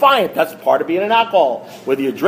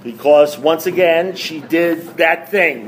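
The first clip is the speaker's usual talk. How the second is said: ordinary talk, nothing marked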